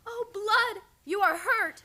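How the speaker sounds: alarmed. Acted. Narisha seeing her husband's wound, alarmed cry.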